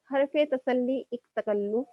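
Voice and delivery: slow, measured recital of a couplet; poem recitation. Shown as a demonstration. Teacher recites an Urdu couplet to her students, slow and measured. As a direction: recite the verse slowly, lingering on each phrase. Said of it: "ایک شعر سناتی ہوں".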